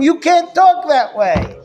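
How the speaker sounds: a mock yell up high and slow; father yelling back. Father grounding his yelling son, in a mild mock yell. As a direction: play the stern father laying down the punishment. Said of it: "And the father tells him".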